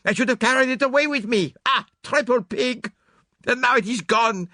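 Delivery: put-on furious self-reproach, high, loud and accented; Poirot. A narrator's reading. Poirot berates himself over the lost case, furious outburst. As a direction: rage at yourself, drawing out each exclamation. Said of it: "his anger burst forth freely".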